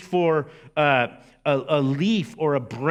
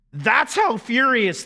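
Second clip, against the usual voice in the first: voice cracking; 6 semitones higher, 3 dB louder than usual. Real, not acted. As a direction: voice cracks on a loud, high cry. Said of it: "yes, my voice just cracked".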